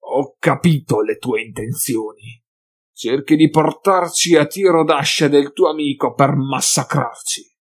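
lightly voiced menacing mutant leader; a hint of a sneering mutant chief; capobranco mutante. A narrator's reading. (capobranco mutante minaccioso, appena accennato)

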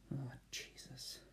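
slow breathy whisper of exasperation. Real, not acted. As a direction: sigh it out as a whisper. Exasperated aside.

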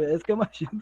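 normal talking voice

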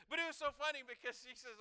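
usual speaking voice

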